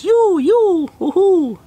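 very high, loud whoop of joy; gleeful cheer. Acted. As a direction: squeal with delight at the trade. Character celebrates her new sock. (grito de alegría muy agudo y fuerte)

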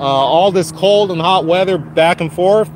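ordinary talk, nothing marked

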